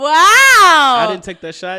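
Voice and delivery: drawn-out indignant yell; loud protest. Acted. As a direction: stretch it out loud and offended.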